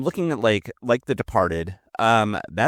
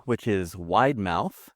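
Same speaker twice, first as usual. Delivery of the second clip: usual speaking voice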